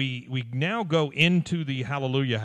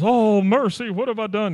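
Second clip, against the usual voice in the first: higher and louder fake dismay; hammed-up mock horror; 8 semitones higher, 7 dB louder than usual. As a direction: overplay the shock as a joke. [name] hams up fake shock.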